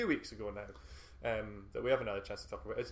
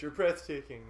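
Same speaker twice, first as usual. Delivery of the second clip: raised, louder heckle; imitated crowd shout; 4 semitones higher, 6 dB louder, slower than usual. Imitating a fan calling to Keanu on stage, shouting. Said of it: "some guy from the crowd shouts".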